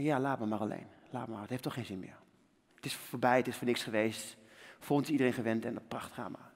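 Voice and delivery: low, soft, giving up; resigned despair. Retold or imitated. Injured skater sends the doctor away, softly resigned. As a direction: let it all go, defeated and quiet. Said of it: "Ik zeg".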